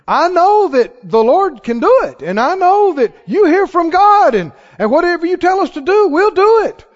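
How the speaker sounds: high-pitched, assured declaration; confident faith. Acted. Disciple answering the Lord about feeding a crowd, confidently. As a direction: declare it with eager, lifted conviction. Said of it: "Can you hear the difference in the tone".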